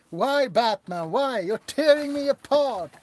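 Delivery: loud, high mock-tragic wail; hammed-up lament. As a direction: ham up a heartbroken cry at Batman. Mock dramatic Batman lament.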